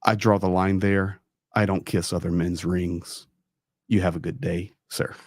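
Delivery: a hint of cool, polite firmness to the priest; firm refusal. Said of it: "then I speak in perfect Russian".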